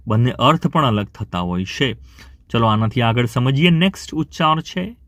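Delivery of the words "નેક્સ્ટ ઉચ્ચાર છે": ordinary talk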